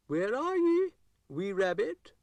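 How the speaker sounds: loud, very high Scottish shout; Scottish calling out. A narrator's reading. Dougal calls out loudly for the hiding rabbit in Scottish. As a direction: call across the garden in Scots.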